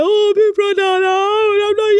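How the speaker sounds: high, loud mock whine; Mensah wailing. Mensah refusing his brother-in-law, high loud wail. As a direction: whine it like a crying kid.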